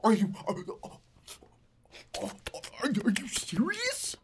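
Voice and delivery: loud, high, halting disbelief; mock shock. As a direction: stammer in hammed-up astonishment, ending stretched high.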